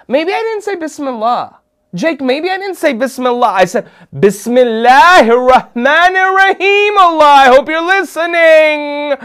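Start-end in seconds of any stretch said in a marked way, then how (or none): 0.1-3.5 put-on high, fretful kid's voice; mock panic. Acts out child's panicked second guess. 4.1-9.2 high, loud, hammed-up plea to heaven; desperate invocation. Desperate louder retry of the invocation.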